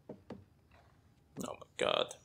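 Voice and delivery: breathless slow whisper; whispered despair. Real, not acted. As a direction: let it out as a drained whisper. Despair at cutscene.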